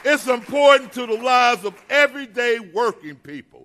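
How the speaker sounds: loud, high rally cry; podium speech. Real, not acted. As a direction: rouse the crowd, shouting out. Rally speech rising to a peak.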